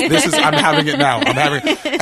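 high, loud mock panic; frantic admission. Tripper confessing to his tea-bringing friend, frantic high outburst. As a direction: blurt it out high and urgent.

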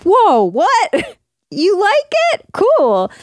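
high, startled "whoa, you like it?"; surprised. Retold or imitated. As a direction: caught off guard by the praise. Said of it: "And I'm like".

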